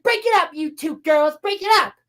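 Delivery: high-pitched mock mom scolding. Mom separates two fighting girls, mock scolding. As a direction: imitate a mother breaking up squabbling kids.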